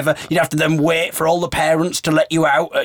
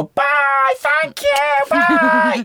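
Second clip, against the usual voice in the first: put-on high squeaky children calling goodbye; very high singsong child voice; primary school kids; 14 semitones higher, much slower than usual. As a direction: mimic a class of kids chorusing farewell. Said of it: "these primary school kids".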